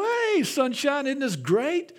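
put-on high, drawn-out singsong hello to a child; cheery morning greeting. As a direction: greet a little kid with playful cheer.

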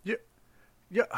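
ordinary talk, nothing marked

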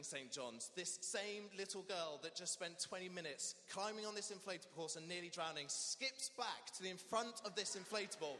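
ordinary talk

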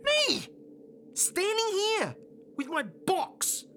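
high-pitched wounded outburst; indignant protest. Acted. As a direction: cry it out in protest, high and strained. Hurt protest in argument.

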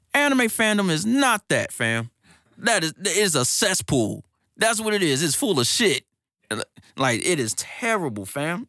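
high-pitched, emphatic disgusted rant; exasperated rant. Real, not acted. Ranting against anime fandom.

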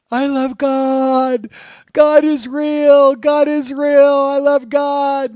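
high, very loud, drawn-out mock wail; imitated crying out. Youth carried forward by the others cries out to God, imitated wail. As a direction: belt it out slowly, imitating an overcome convert. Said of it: "He's crying".

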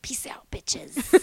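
slow sassy whisper; Charlie Bradbury. Retold or imitated. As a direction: breathe the farewell out slowly with sass. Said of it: "I'm thinking Charlie Bradbury".

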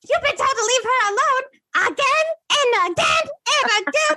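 high, far-off imitated coworker snapping; snapped warning. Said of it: "he, like, snapped".

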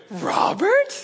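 high-pitched mock astonishment; incredulous, voice shooting up high; astonished. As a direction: imitate a girl's amazed question. Retold girl's astonished reaction.

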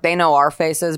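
ordinary delivery

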